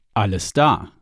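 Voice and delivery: ordinary delivery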